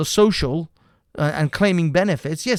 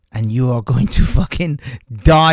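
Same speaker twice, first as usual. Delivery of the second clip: louder, laughing through the taunt; gloating, chuckling at them; mocking laugh; 3 semitones lower, 6 dB louder than usual. Real, not acted.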